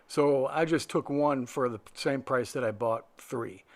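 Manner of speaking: ordinary delivery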